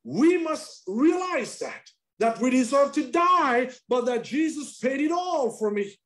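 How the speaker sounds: high, loud proclamation, up to a shout; shouting preacher. Acted. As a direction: proclaim it over the whole church. Preacher urging sinners to repentance.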